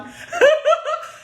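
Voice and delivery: real incredulous laugh; high amused laugh. Real, not acted. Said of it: "God, do I have to still teach".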